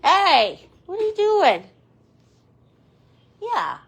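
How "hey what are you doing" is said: loud, high call to a pet; raised, high-pitched calling. Real, not acted. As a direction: pitch it up and call over to the bird. Calling out to a bird.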